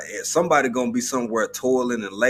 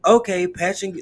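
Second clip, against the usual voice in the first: high, bright switchboard operator voice; old-time operator; 8 semitones higher, 4 dB louder than usual. Retold or imitated. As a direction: answer like a busy operator. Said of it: "like how operators used to be".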